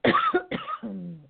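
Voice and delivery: high choking cough; hacking, choking cough; dry cough. Real, not acted. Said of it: "I'm choking up about it".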